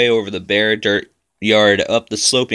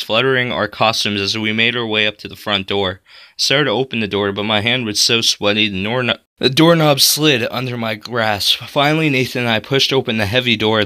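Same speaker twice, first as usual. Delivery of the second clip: usual speaking voice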